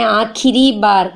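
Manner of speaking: ആഖിരി ബാർ drawn out slowly; stressing the phrase. Shown as a demonstration. Teacher dwelling on ആഖിരി ബാർ, slowly. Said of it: "എന്താ കഥാകാരൻ പറയണത്?".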